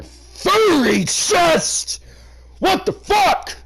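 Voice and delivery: a high, loud squeal of disbelief; shocked outburst. Real, not acted. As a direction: recoil at what you just read. Reader recoils at the text.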